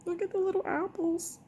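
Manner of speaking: high, delighted coo. Real, not acted. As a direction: coo over them, way up high. Cooing over cute apples.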